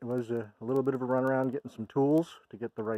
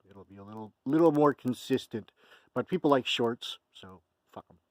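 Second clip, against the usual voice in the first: ordinary delivery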